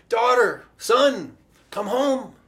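loud, high, pleading call. Acted. God calling wandering children home, loud calling. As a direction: call them home across a distance.

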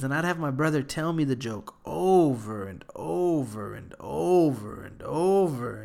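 ordinary talk, nothing marked

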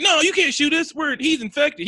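high, pleading falsetto; frantic plea. Retold or imitated. The friends begging the cops not to shoot, panicky.

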